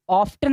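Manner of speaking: ऑफ्टन with the T sounded; wrong pronunciation. Shown as a demonstration. Teacher warning pupils off a mistake, wrong pronunciation. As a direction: put a hard T after the F in ऑफ्टन. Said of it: "ऑफ्टन गलत होगा बच्चा".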